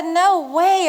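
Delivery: playful outraged denial; indignant protest. Retold or imitated. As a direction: protest fast and bright. Arguing with husband over smell.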